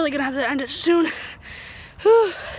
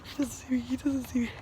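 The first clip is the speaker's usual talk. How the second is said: whispering; 6 semitones lower, 11 dB quieter than usual. Real, not acted. Sneaking up on opponent.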